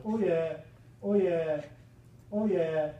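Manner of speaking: loud, hyped-up oh yeah; loud gloating cheer; pumped up. Retold or imitated. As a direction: chant it like a pumped-up knight. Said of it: "She's pumped".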